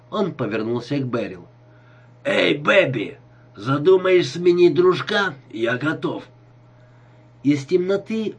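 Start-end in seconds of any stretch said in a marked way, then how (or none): 2.2-6.2 put-on loud, higher drunken pitch to a woman; brash drunken holler; drunk come-on. A narrator's reading. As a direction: proposition her like a noisy boozer. Drunk Clint propositions the woman.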